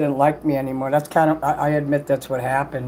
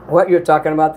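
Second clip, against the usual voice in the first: usual speaking voice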